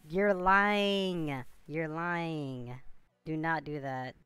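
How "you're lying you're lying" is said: ordinary talk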